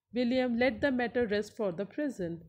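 normal talking voice

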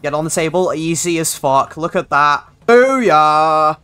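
loud, high triumphant shouting; excited celebration. Real, not acted. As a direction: roar it out like you just won. Celebrating beating the boss.

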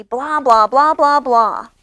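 loud run of blahs; TV babble. A narrator's reading. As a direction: imitate television chatter at full volume. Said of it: "goes the T V".

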